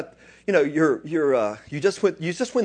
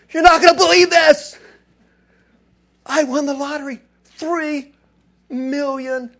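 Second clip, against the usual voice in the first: much higher and louder, shouting the big news; bursting with excitement; 12 semitones higher, 10 dB louder than usual. Shown as a demonstration. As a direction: yell it to the neighbor over the fence. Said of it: "you wouldn't say it like that".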